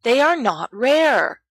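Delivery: put-on indignant boy denying it; protesting. A narrator's reading. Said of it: "James protests".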